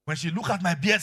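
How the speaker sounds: ordinary delivery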